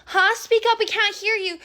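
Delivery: high, loud mocking heckle; a bully's loud, high sneer. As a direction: heckle her loudly, sneering. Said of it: "Karina's like".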